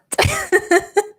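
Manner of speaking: real high-pitched laugh; amused high laughter; high giggle. Real, not acted. Amused explaining a slang phrase.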